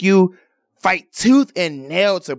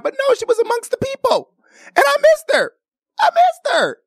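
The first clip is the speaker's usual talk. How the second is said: mock wailing. Vlogger mock-crying to viewers about missing [name]. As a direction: wail it like a fake heartbreak.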